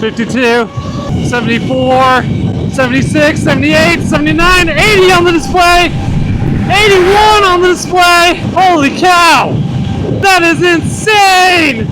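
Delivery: building to an ecstatic high yell; excited shouting. Real, not acted. Top-speed run, shouting readings.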